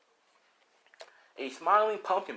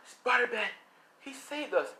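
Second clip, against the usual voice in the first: high-pitched awed cry; amazed civilians; 11 semitones higher than usual. Acted. Civilians just rescued by Spider-Man, amazed high cry. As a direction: marvel in a higher voice. Grateful civilians cheer hero.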